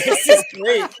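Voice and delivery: cracking up at the slang book; laughing delight. Real, not acted. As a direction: squeal with laughter.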